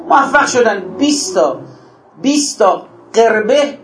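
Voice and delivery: ordinary talk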